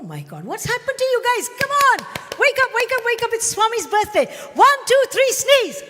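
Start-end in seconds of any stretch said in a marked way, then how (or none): 0.4-4.3 much higher and louder, urging; rousing the crowd. Real, not acted. Teacher rousing the audience for Swami's birthday, excited. 4.5-5.8 much higher, louder count; calling out the cue. Real, not acted. Teacher cueing the audience's sneeze, loud call.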